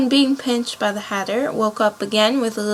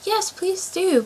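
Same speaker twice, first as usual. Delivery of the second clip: higher, slow pleading; 10 semitones higher, slower than usual. A narrator's reading. Alice pleading with the Dormouse for a story, higher and slow. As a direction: coax them gently into a story. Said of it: "pleaded Alice".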